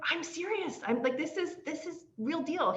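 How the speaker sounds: excited insistence. Retold or imitated.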